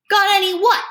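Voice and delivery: high, loud, defiant question; defiant challenge. A narrator's reading. Freak stands up to the bully, defiant challenge. Small kid defies the bully.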